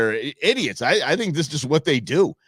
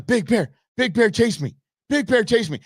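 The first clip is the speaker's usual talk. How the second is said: mock taunting bear; 10 semitones higher than usual. Voicing the man in the movie.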